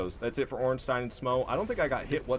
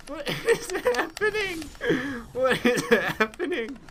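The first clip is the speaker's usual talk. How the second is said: laughing disbelief; 13 semitones higher, slower than usual. Real, not acted. Glitch keeps going.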